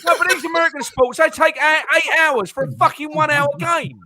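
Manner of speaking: loud, high-pitched exasperated rant; worked-up rant. Real, not acted. Football fan railing at long American games, worked up. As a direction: rant as if about to have a seizure. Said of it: "I think he's having a seizure".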